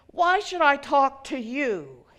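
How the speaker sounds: loud, hostile demand. Acted. Drunk man confronts the elderly man, loud demand. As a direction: challenge him loudly. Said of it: "the man demanded".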